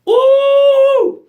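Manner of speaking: high, loud, sustained "woo"; excited whoop. Real, not acted. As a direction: let out a long celebratory whoop. Cheering her own gratitude moment.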